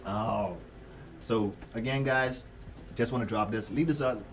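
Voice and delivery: normal talking voice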